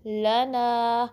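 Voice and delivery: slow लना with thin l and long ना; drawn-out लना on a light l; light lam. Shown as a demonstration. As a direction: keep ल flat-tongued, stretch ना. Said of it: "लाम को बारीक पढ़ना है".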